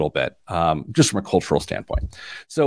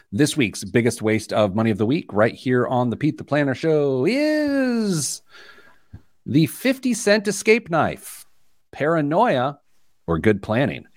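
show announcer; 4 semitones higher than usual. Acted. Host unveiling the week's biggest waste of money, announcer style. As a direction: announce it big, with deliberate pauses.

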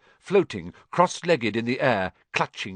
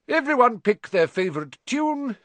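put-on much higher, bright old wizard voice; Dumbledore; 11 semitones higher, 4 dB louder than usual. A narrator's reading. Headmaster starting the school song, gleeful high call. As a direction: sing it out like a playful headmaster. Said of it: "said Dumbledore".

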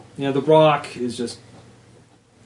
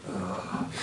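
grunt; 7 semitones lower, 11 dB quieter than usual. Acted. GM voices character's grunt.

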